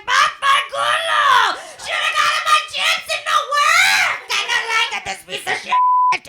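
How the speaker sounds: put-on high, loud Italian-accented screeching; hammed-up possessed rant at a phone; mad cursing grandma. Grandma cursing the phone in Italian, furious shouting. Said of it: "starts getting mad and cursing me".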